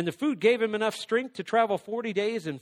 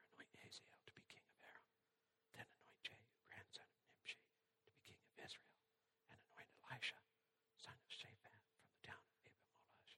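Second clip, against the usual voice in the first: long, barely audible whispered command; hushed, voiceless scripture reading; gentle whisper; 7 semitones lower, 34 dB quieter than usual. A narrator's reading. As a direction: whisper softly as the voice of God. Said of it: "the sound of a gentle whisper".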